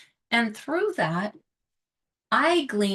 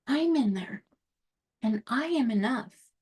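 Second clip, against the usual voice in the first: ordinary talk, nothing marked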